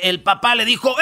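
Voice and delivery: ordinary talk, nothing marked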